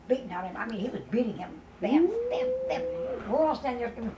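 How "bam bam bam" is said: high-pitched repeated smacking noises; imitating hits, high and sudden; imitated blows. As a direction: punch out each blow as a sound.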